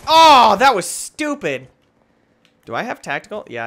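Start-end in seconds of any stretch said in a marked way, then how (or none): 0.1-1.7 frustrated outburst. Real, not acted.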